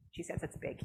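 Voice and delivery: unvoiced whisper to the viewers; whispered aside. The counselor whispers the puppet's answer to viewers. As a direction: lean in and whisper it.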